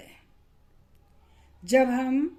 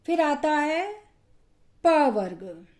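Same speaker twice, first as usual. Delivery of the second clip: normal talking voice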